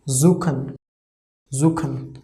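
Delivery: zuchen said zoo-ken with a k sound; German pronunciation demo. Shown as a demonstration. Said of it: "pronunciation of C H is k".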